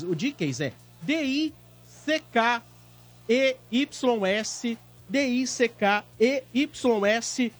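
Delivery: letter by letter, slow and clear; spelling it out. Shown as a demonstration. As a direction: spell out each letter of DICKEYS. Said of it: "Eu vou soletrar pra vocês".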